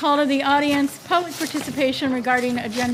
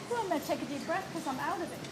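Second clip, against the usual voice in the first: quick aside; 2 semitones higher, 12 dB quieter, much faster than usual. Real, not acted. Quick aside before the pledge.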